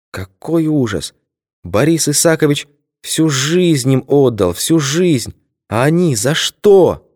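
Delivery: put-on slow, high old lady's lament. A narrator's reading. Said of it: "воскликнула Изольда Генриховна".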